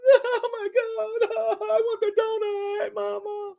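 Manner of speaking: high, hammed-up crying kid with laughs; mock a kid wailing for the donut; whiny crying kid. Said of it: "They were mad".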